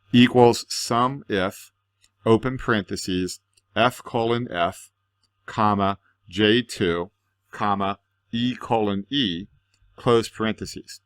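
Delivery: slow, spelling out each formula part; dictating. Shown as a demonstration. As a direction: read the formula aloud slowly, piece by piece. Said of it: "The whole thing says".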